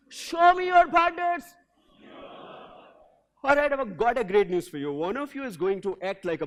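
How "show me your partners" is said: louder, higher and slowed; with energy. Shown as a demonstration. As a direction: punch "your" with energy. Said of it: "you got to say with energy".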